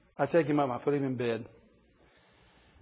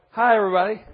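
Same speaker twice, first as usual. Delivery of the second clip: put-on higher, louder bright child's greeting; cheery little boy; 4 semitones higher, 6 dB louder, faster than usual. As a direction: play the kid popping in with a big hello. Toddler sneaking downstairs again.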